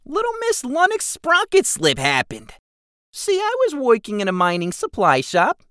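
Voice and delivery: high goblin voice, reminiscing; goblin storytelling. Acted. Keswick tells Jigsaw about his girlfriend, high and squeaky.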